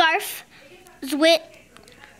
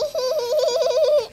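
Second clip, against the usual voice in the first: a high burst of real laughter; high amused giggle; 9 semitones higher than usual. Real, not acted. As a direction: giggle at the funny page. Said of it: "That's so funny".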